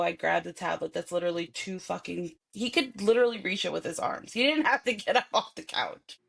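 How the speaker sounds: normal talking voice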